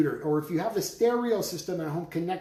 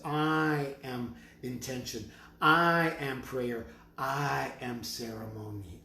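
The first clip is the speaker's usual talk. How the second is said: slow solemn declaration; slower than usual. Acted.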